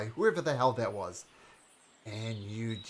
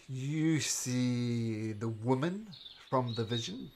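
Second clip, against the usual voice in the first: usual speaking voice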